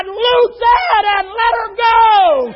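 high, slow shouted command; crying out. Acted. Jesus orders the listener's grief loosed, crying out. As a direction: stretch it out at the top of the voice. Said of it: "He'll cry".

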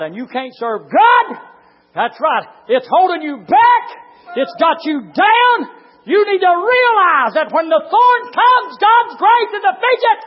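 high, loud, drawn-out pulpit shout; full-force preaching. Real, not acted. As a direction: shout the scripture slowly to the congregation.

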